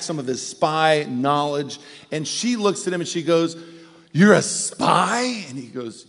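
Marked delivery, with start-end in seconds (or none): 4.1-5.5 slow, stunned, higher and louder; shocked question. Retold or imitated. Wife learning her husband is a spy, stunned. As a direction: draw it out in disbelief.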